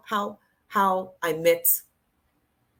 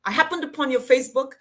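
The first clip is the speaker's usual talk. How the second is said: ordinary talk, nothing marked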